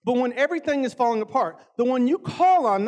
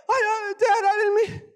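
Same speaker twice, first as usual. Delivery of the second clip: put-on higher, flustered girl's voice; imitating daughter; 10 semitones higher, 3 dB louder than usual. Dad mimics his daughter answering him, higher and flustered. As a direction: play the apologetic daughter, pitched up.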